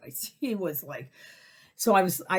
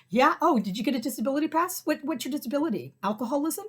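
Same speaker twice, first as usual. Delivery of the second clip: high, fast sneering questions; sarcastic mock confrontation; 9 semitones higher than usual. Imagined taunt at the drunk, high and sarcastic. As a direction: needle him with mock concern.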